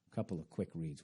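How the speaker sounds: ordinary delivery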